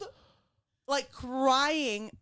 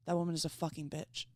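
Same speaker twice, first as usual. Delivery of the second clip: low, soft deadpan verdict; imitated calm husband, understated; calm, subtle husband; 5 semitones lower, 7 dB quieter than usual. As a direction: deliver the insult quietly and evenly. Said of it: "really calm and subtle".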